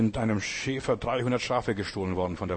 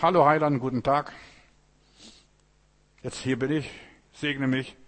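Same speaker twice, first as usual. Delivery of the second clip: mock casual greeting. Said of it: "Nur im Gottesdienst".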